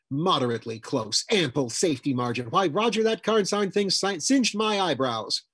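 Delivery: indignant, rising to high and loud; fuming. A narrator's reading. Woman fuming to Roger about the near miss, indignant. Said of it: "She went on fuming".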